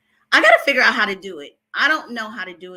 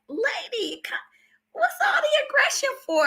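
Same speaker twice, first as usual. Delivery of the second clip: high, hushed, mock-baffled whisper. Whispering back at a rude commenter, high and hushed. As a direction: breathe it high and unvoiced in disbelief. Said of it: "And I'm like".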